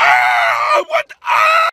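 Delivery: shocked scream. Acted. Phone dropped down shaft.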